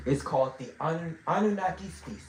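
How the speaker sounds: stumbling over "Anun-, Anunnaki"; stuttering. Real, not acted. Teacher naming the species to the class, stuttering. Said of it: "I don't know why I'm stuttering".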